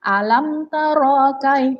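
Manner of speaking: "taro" with the ro wrongly drawn out long, slowly; demonstrating the wrong long ro; wrong reading demo. Shown as a demonstration. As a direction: show the common mistake by stretching "taro". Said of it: "itu salah ya".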